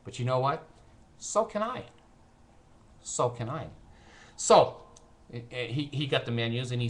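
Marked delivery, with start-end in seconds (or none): none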